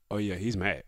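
low, hushed observation; retold teammate aside. Teammate watching the blow-up, low and quiet. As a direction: voice a teammate quietly noting the anger. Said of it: "Ron Clark was like".

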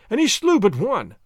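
pressing the accusation loudly; crying out. A narrator's reading. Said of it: "I cried".

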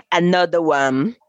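slow mock American English; English accent Portuguese. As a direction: drawl it like the rap hook. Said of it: "Tu precisa ver as músicas, [name]". (imitando o inglês do filho devagar)

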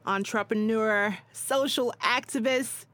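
loud, high hype-man intro; grand entrance announcement. Acted. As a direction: build the crowd up for the guest. Introducing guest to the audience.

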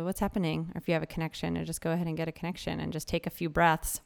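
soft hushed meditation guide; guided meditation. Acted. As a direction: gently invite the listener to breathe and look inward. Leads a guided check-in.

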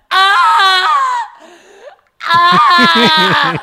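loud high wailing "ah" cries; hammed-up crying, blasted out high; imitated movie cry. Imitating Claire Danes crying at film's end, hammed-up wail. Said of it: "just, like, her going".

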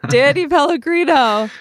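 big host announcement, voice cracking at the top. Acted. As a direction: belt the name until the voice cracks. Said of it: "my voice cracked at the end".

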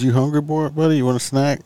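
put-on high, loud child-directed voice; talking to a child. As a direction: call to the kid, high and bright.

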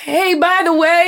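high, slow, drawn-out singsong opener; mock casual opener. Awkward sibling attempting affection, exaggerated casual lead-in. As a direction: act out forced ease. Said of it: "It's very hard for me to say".

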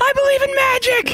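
high, squealing mock shout; quoted movie yell. Caller replaying the drunk Paul Blart line, high mock yell. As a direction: throw the line up into a falsetto yell.

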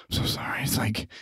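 unvoiced hushed apology; whispered. Real, not acted. Apologizing to the old man, whispered. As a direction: whisper it, embarrassed.

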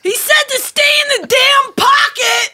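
high angry shout. Acted. Wind guy yelling at the showrunner, shouting.

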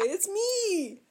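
high, playful announcement; hammed-up joyful self-introduction; mock reveal. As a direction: pipe up as if greeting an old acquaintance. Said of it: "Drop trow and say".

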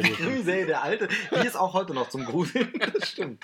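laughing. Real, not acted. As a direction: chuckle through the joke. Laughing at the crime-series mixup.

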